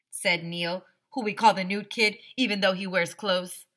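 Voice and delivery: plain reading voice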